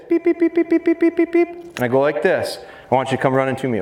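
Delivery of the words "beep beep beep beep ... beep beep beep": high beeping like a whistle; whistle beeps. Retold or imitated. Showing the crowd his whistle signal, high beeps. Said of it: "When I blow my whistle like this".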